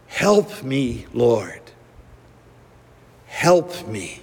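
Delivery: low, slow, calm plea; calm prayerful plea. Retold or imitated. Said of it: "asks in a calm, prayerful voice".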